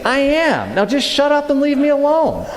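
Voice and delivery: high-pitched mock outrage; comic fed-up outburst; indignant retort. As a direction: tell the inner voice off, exasperated. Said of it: "that's really was my reaction".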